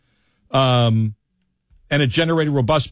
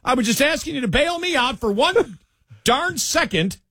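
high-pitched, exasperated complaint; exasperated protest; 13 semitones higher than usual. Real, not acted. Host to co-host who failed to bail him out, exasperated. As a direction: protest in a much higher voice, half laughing.

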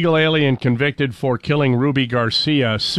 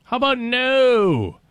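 dismissive judge voice, much higher, slowing on the refusal; mock a smug judge turning the case down; 10 semitones higher than usual. Host mocking a judge's ruling on the lawsuit, in a higher, dismissive judge voice. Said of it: "some judge that says".

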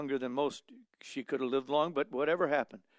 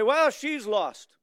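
mock dismissive; 8 semitones higher, 4 dB louder, much slower than usual. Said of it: "So you'd say".

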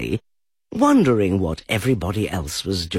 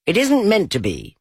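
rabbit voice; 11 semitones higher than usual. A narrator's reading. Rabbit pretending not to be Rabbit.

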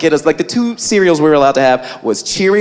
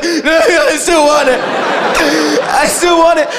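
higher, laughing plea; cling to it like an addict; needy addict kid; 6 semitones higher, slower than usual. Retold or imitated. Brother still wanting the cereal, higher laughing plea. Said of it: "He was like".

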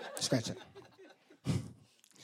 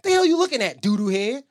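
put-on annoyed teen sister; 5 semitones higher, 5 dB louder than usual. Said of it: "She instantly goes".

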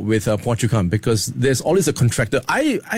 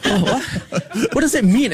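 baffled; 8 semitones higher than usual. Retold or imitated. Bewildered reply.

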